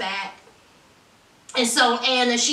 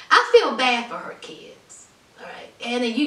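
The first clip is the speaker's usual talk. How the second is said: ordinary talk, nothing marked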